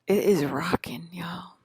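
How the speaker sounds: whispered aside; hushed playful whisper. Real, not acted. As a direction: breathe it out secretly. Hotel party, hushed aside.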